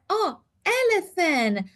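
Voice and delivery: high, slow, pleased "Oh, elephant" with the stress on "elephant"; child answering. Retold or imitated. Child answering the therapist, pleased and slow. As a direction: voice the child finally getting it, happily. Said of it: "and he said".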